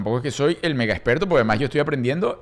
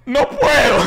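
high, hammed-up little girl's wail; whiny child; 10 semitones higher, 4 dB louder, slower than usual. Father mimicking his daughter stuck on the slope, whining. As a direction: squeak it like a stuck kid. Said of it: "Samantha, sube".

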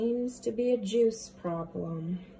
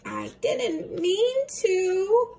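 put-on child excuse; 7 semitones higher, 3 dB louder, much slower than usual. David excuses himself.

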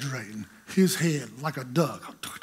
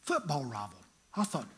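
usual speaking voice

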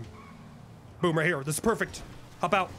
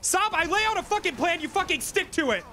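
high-pitched real exasperation; angry scolding; 7 semitones higher than usual. Real, not acted. Boss telling Saab to stick to the plan, angry. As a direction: lay into him, pitched high. Berating teammate for ignoring plan.

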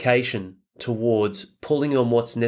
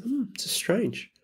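higher, softer and slow, a mock puzzled hum; puzzled musing; 11 semitones higher, 6 dB quieter, slower than usual. Al noticing his own unease, puzzled soft musing. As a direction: muse it to yourself, quietly baffled. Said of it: "I was like".

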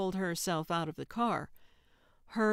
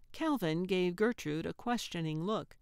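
plain reading voice

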